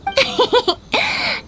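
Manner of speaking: a high, playful giggle; bright rising laugh. Acted. Yoimiya giggles to lift the disappointed officer's mood. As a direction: laugh to cheer him up.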